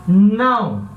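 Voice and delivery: "não" standing alone, heavily stressed and drawn out; emphatic NÃO. Shown as a demonstration. Teacher warning students about the rule's exception, emphatic "não". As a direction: make "não" a big, weighty word. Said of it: "presta atenção nesse não grande aqui".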